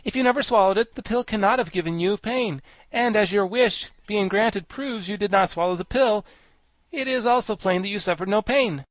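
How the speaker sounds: high-pitched, projected character voice; high, emphatic storybook know-it-all; Wogglebug, pedantic explainer. A narrator's reading. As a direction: explain it like a lecturing insect.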